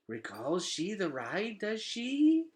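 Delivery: a hint of a slow bug voice rising at the end; lightly voiced hopeful roach; roach asking. Roach asks the toddler.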